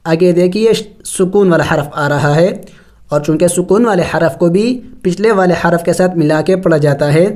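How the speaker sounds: ordinary talk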